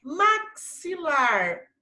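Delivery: "Maxilar" high and slow, with a marked ks. Shown as a demonstration. Teacher repeating the sound for pupils, "Maxilar" with clear ks. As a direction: sound out "Maxilar" as mak-si-lar. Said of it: "Ó o sonzinho".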